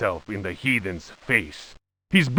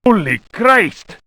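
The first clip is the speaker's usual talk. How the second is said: hammy cry, much higher pitched; bad acting; 8 semitones higher, 4 dB louder than usual. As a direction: blurt it out in stiff shock. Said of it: "cried Captain Cortez".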